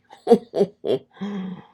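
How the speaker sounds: loud, high burst of laughter; sudden high cackling laugh. Real, not acted. Laughs at his own taunting verdict.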